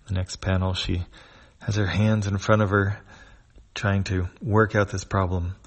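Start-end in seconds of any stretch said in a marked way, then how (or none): none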